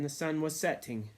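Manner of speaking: ordinary delivery, nothing marked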